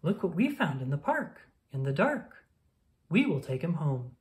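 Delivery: ordinary delivery, nothing marked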